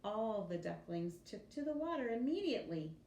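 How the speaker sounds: plain reading voice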